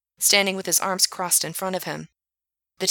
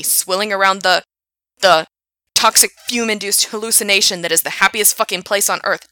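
furious rant; 7 dB louder than usual. Acted. Frank ranting at Gerard.

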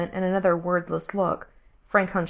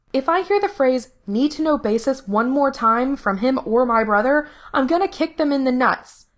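higher, emphatic irritated rant; exasperated; 7 semitones higher, 4 dB louder than usual. A narrator's reading. Diana fed up with secretive men, exasperated. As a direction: let fed-up irritation push the pitch up.

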